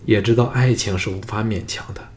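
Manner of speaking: plain reading voice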